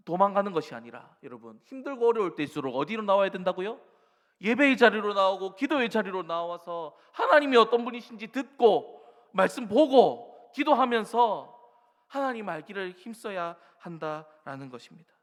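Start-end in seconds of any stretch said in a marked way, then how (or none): 4.4-11.5 fervent preaching. Acted. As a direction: press the point hard. Preaching emphasis.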